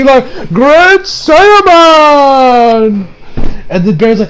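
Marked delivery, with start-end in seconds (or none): 0.5-3.1 high, very drawn-out heroic announcement; heroic name call. Retold or imitated. Gohan posing on set, drawn-out heroic name shout. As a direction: stretch the name out like a hero's battle cry.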